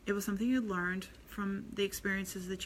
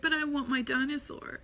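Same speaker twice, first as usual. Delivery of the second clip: imitated child's protest, raised pitch; upset little boy; 6 semitones higher than usual. Parent imitating a son pleading for a toy, upset higher child voice. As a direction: mimic a small child insisting, pitched up. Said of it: "and he was upset".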